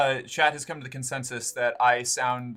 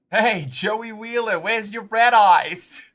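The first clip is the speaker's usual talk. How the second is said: slow, loud, higher Boston mom calling out; mom's voice; 7 semitones higher, 10 dB louder, slower than usual. Pretend. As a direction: call across the room like a middle-aged mom, drawn out. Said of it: "trying to see if I can do a voice like what my mom had".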